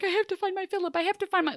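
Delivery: very high, put-on damsel voice; mock desperation. Aurora needing to find Philip, mock desperate high voice. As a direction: imitate a helplessly obsessed princess.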